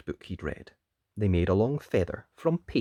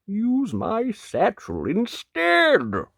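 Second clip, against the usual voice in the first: high, drawn-out suggestion; slow wolf voice, rising high; helpful wolf; 9 semitones higher, 4 dB louder, much slower than usual. Acted. Wolf offering the knight his satchel, helpful.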